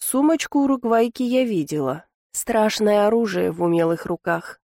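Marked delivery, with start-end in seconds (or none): none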